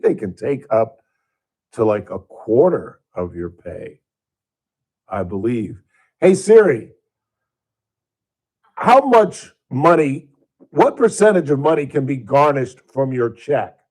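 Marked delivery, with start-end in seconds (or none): 6.2-13.8 loud, deliberate command to a phone assistant. Real, not acted. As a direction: raise your voice and address the assistant.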